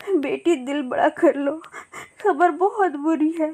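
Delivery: put-on brace the child, voice catching in tears; a tearful aunt gently warning the little girl. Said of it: "रोने लगती है".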